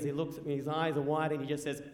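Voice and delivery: normal talking voice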